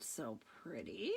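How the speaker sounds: lightly witchy low rasp; witch voice. Real, not acted. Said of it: "I sound like, uh, the witch".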